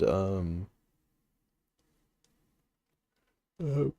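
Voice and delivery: yawning through filler sounds; stifled yawn mid-sentence. Real, not acted. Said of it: "sorry guys, I keep yawning".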